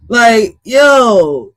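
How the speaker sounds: a loud shout of disbelief; real shocked yell. Real, not acted. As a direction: shout it at the screen. Reacting to shocking clip.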